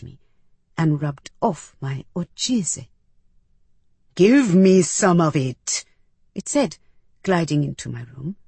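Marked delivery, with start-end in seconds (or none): 4.2-5.8 loud, slow alien command; demanding alien. A narrator's reading. Medus gliding in to ask for ojize, demanding. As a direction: order it in a weighty, deliberate creature voice.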